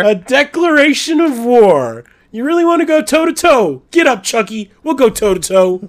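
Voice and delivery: high, loud, hammed-up outrage; over-the-top indignant yelling; mock battle cry. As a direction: holler a play-acted challenge to fight. Acted war cry answering the challenge.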